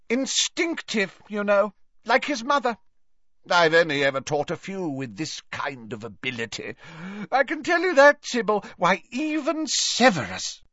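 high, swooping professor's voice; tipsy Slughorn, effusive. Acted. Merry professor boasts to a colleague about his pupil, high and effusive.

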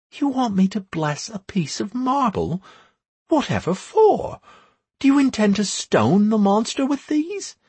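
high-voiced, baffled questioning; incredulous priestess. A narrator's reading. Priestess asked to bless marble, high and incredulous.

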